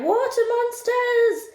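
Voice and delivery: very high, drawn-out shriek; hammed-up squeal of alarm; terrified cry. A narrator's reading. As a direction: wail it slowly in mock terror. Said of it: "and one cried".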